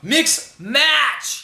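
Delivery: high, loud playful yell; excited shout. Acted. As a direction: belt it out like a catchphrase. Playfully echoes co-host's phrase loudly.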